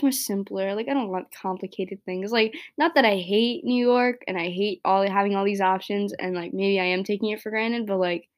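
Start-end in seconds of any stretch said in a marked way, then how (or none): none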